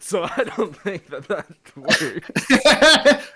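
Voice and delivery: laughing through the words, slow; giggling while speaking. Real, not acted.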